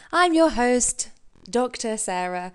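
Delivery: playful posh-host tone; mock fancy host. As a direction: put on a fancy presenter voice. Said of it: "I sound like an idiot when I use that".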